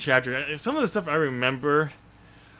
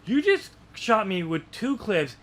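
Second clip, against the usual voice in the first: incredulous hero; 7 semitones higher than usual. Retold or imitated. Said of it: "and he's like".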